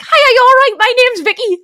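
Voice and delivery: high, loud, bubbly hello; high loud fangirl hello to a famous presenter; overeager greeting. Retold or imitated. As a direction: gush at a celebrity you're starstruck by. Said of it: "being like".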